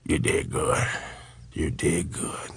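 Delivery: a hushed imitation of Red Fox. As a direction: pass on Red Fox's praise in a hushed whisper. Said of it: "he looked at me and he said".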